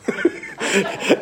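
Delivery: a high, breathless burst of laughter; breathy laugh. Real, not acted. Pastor laughing while retelling the prank, breathy laugh. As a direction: laugh at your own prank. Laughs at his own joke.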